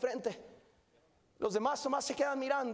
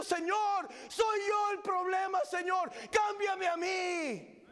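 pleading cry; 6 semitones higher, slower than usual. Acted. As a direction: cry out as a broken servant at the altar. Repentant servant crying to God.

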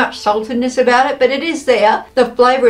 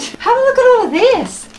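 excited; 12 semitones higher than usual. Real, not acted. Excited unboxing of a treat box.

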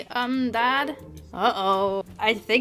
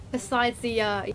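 ordinary talk, nothing marked

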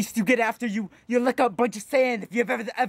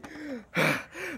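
calming sigh; 3 semitones higher, 7 dB quieter than usual. Acted. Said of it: "I need to calm down".

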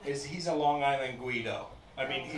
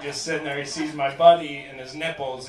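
ordinary delivery

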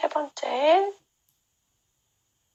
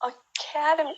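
Academy with ca hit higher; stress on ca; 4 semitones higher, 3 dB quieter, much slower than usual. Shown as a demonstration. Teacher demonstrating stress on ca. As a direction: lift the second syllable, ca, up high. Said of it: "캐의 강세가 있고요".

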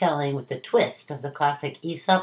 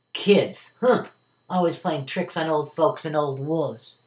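ordinary delivery

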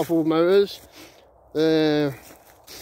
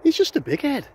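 high, scornful dismissal; scornful rant; 8 semitones higher than usual. Real, not acted. Vlogger ranting at Andrew Tate, high scornful dismissal. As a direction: cut the big head down to size.

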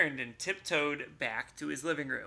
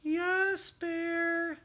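sighing mouse; 13 semitones higher than usual. Acted. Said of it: "sighed Mouse".

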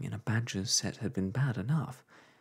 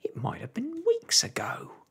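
much higher, breathy alarm; exclaiming; 4 dB louder than usual. A narrator's reading. Wife realising Loopy left weeks ago, alarmed. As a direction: gasp the worry out. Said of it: "exclaimed Homily".

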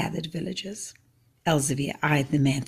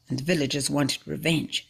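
ordinary delivery

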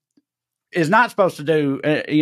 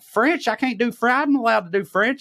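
high-pitched indignant protest, acted out; 10 semitones higher than usual. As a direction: replay an outraged inner rant, voice jumping way up. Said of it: "I was indignant about it".

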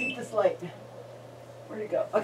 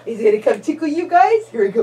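ordinary talk

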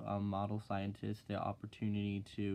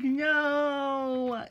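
high, loud deadpan no; flat mock no; 13 semitones higher, 8 dB louder than usual. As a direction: shut her down with one word. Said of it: "I'm like".

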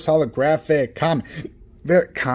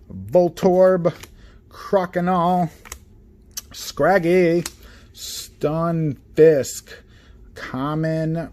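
ordinary talk, nothing marked

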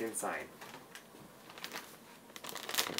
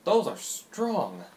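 amazed; 5 semitones higher, 7 dB louder, much slower than usual. Real, not acted. Reacting to strong smell.

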